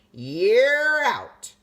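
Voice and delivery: loud, booming out call; loud umpire call. A narrator's reading. Umpire calling the batter out, booming. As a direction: bellow the out.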